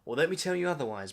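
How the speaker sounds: ordinary talk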